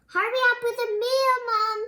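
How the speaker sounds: high, loud, fast whiny call; loud impatient boys; bratty boys calling. Acted. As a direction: rush the demand like spoiled kids. Boys demanding their meal.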